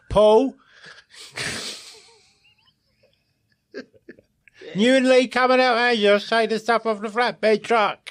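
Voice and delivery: high, loud bellow at the minions; shouted orders. Retold or imitated.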